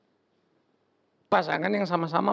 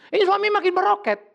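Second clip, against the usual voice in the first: high, emphatic exclamation; excited exclamation; 12 semitones higher, 3 dB louder than usual. Real, not acted. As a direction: build it up like a rocket. Exclaiming husband's rise. (seruan bersemangat, nada tinggi)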